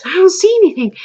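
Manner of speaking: high-pitched puzzled denial; high quoted voice of a grandmother; retold grandmother. Retold grandmother denying again.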